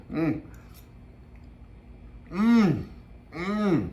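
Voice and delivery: chewing hot food, mouth full; savoring noisily between bites; messy loud eating. Real, not acted. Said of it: "I hate to talk with my mouth full".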